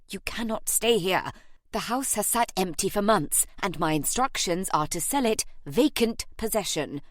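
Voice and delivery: ordinary delivery, nothing marked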